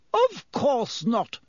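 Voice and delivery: high, slow, offended; bristling. A narrator's reading. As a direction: take offence at the very idea.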